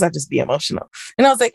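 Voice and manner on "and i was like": ordinary talk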